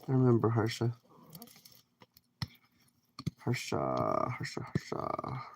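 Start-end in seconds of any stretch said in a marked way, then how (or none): 3.4-4.6 lower, slow musing over the name; drawn-out name. Pretend. Plays with a viewer's name. 4.6-5.4 hushed whispered name. Pretend. Plays with a viewer's name.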